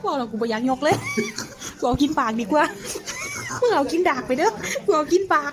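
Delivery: loud tipsy laughing banter; laughing loudly. Real, not acted. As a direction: shout it through laughter. (หัวเราะเสียงดังขณะเมา)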